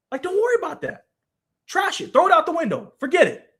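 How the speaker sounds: telling J D to trash the NIL deal, worked up; exasperated urging. Real, not acted. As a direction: dismiss it all with rising heat. Urging J D to drop it.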